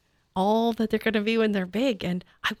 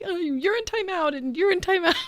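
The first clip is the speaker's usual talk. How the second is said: laughing mock scolding; 10 semitones higher than usual. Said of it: "I would just be like".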